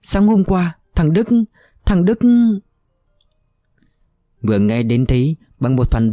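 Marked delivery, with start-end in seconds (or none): 0.0-2.2 higher, desperate confession; a broken old woman begging to be punished; distraught pleading. A narrator's reading. Aunt begs, body shaking. (bà thím van lơn trong tuyệt vọng)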